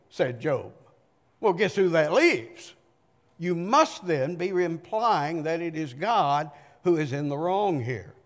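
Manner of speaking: ordinary delivery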